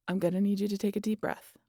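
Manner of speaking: ordinary delivery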